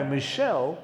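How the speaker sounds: ordinary delivery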